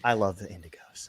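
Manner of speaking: softly whispered aside of love. Real, not acted. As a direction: whisper it like a secret confession. Fond aside about indigo snakes.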